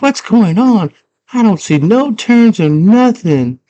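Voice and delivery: put-on high, puzzled girl voice; frustrated girl voice. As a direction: sound lost and high. Girl lost in maze.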